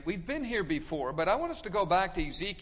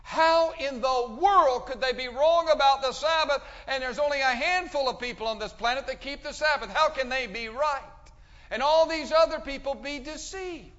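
higher, louder voice of a baffled questioner; imitated disbelief; 7 semitones higher, 6 dB louder than usual. Preacher retelling people's doubts about the Sabbath, incredulous. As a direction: imitate someone pleading that they cannot believe it. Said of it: "say to me".

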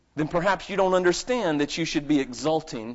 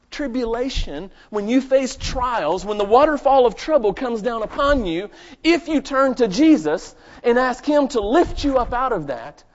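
louder, higher preaching push; full-force preaching; 8 semitones higher, 8 dB louder than usual. Acted. Pastor confessing to the congregation, louder full preaching. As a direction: drive it home from the pulpit.

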